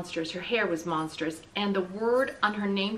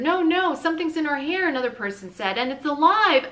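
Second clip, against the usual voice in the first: high, loud frightened cry; alarmed shout; 7 semitones higher, 6 dB louder than usual. A narrator's reading. Another diner cries out that something's in her hair. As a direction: call out in alarm.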